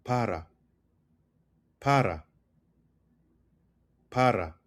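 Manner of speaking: para with one quick R; tapped R. Shown as a demonstration. Teacher repeats the word for "for", "para" with a light tapped R. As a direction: say "para" with the tongue flicking once. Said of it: "which is for".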